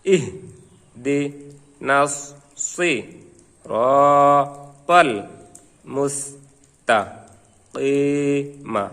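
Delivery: each Arabic syllable alone, with a pause after it; teacher's syllable-by-syllable recitation drill; broken-up syllables. Shown as a demonstration. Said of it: "ভাঙ্গা ভাঙ্গা উচ্চারণ করব". (ভাঙ্গা ভাঙ্গা করে উচ্চারণ)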